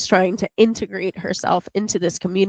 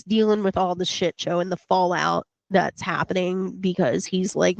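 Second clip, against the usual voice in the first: ordinary talk, nothing marked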